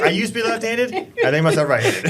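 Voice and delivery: high, laughing impersonation; mock quote through laughter. Teasing a friend about his hand, laughing mock quote. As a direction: crack up while putting words in his mouth.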